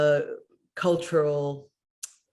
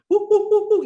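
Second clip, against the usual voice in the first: loud, high rhythmic hooting; imitated chimpanzee hoots; 9 dB louder, faster than usual. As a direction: hoot out a chimpanzee call. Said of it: "trying to speak the language of the chimpanzee".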